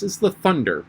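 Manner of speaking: high, certain finish; very decided. A narrator's reading. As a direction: land the answer decidedly. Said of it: "Alice said very decidedly".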